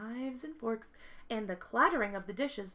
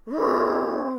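loud growling roar; bear roar; 2 semitones higher, 11 dB louder than usual. Acted. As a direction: roar like a big brown bear. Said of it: "roared the big brown bear".

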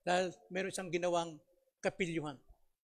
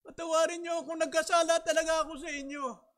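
put-on crying child; 10 semitones higher, 6 dB louder than usual.

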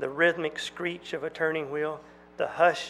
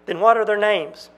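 loud, sharp demand, higher; 4 semitones higher, 9 dB louder than usual. A narrator's reading. Twin demanding the girls' names from Jack, loud demand. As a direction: insist on an answer. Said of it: "demanded one".

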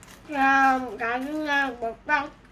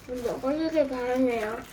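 ordinary delivery

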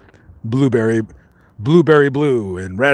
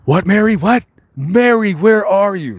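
calling out; 5 semitones higher than usual. Pretend. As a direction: shout into the dark for Mary, playfully alarmed. Calling out to a ghost.

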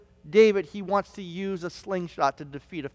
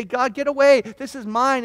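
raised, higher-pitched mock protest; possessive protest; 6 semitones higher, 6 dB louder than usual. Believer refusing to give things to God, mock protest. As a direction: push God away, lightly acted.

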